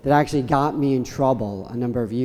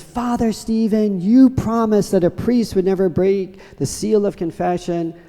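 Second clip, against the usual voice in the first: higher, a lightly voiced accusing girl; mild kid voice scolding the priest, hands on hips; indignant little girl; 7 semitones higher than usual. Little girl reproaching Father Stephen, lightly voiced indignant.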